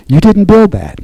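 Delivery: "you didn't build that" pitched high, in a mock political tone; a light jab, lifted high like a famous soundbite; quoting a politician. Preacher borrowing a politician's line, lightly mocking. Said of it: "to borrow the words of a well-known political figure".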